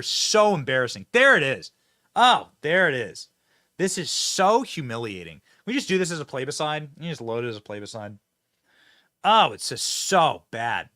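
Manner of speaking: ordinary talk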